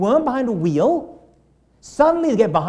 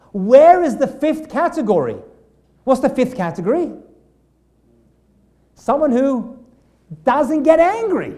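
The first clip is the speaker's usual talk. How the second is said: emphatic question; 6 semitones higher, 7 dB louder than usual. Real, not acted. Teacher posing the key question.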